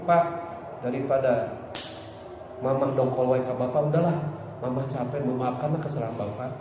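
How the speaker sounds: low, soft, lightly acted weary wife; wife to husband. Wife tells her husband she forgives him, soft and resigned. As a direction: gently let it go, speaking quietly as a wife. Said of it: "suami ibu".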